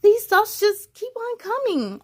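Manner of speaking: a touch of distress; understated crying line; mild cry. Acted. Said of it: "I cry".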